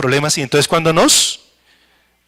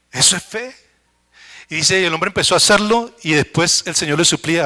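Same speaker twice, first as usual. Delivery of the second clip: ordinary talk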